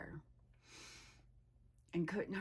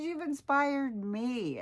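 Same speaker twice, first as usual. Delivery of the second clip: high, louder, slow retold praise; emphatic praise; 8 semitones higher, 6 dB louder, slower than usual. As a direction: stretch out a friend's admiring words, pitched up. Acting out friend's heartfelt praise.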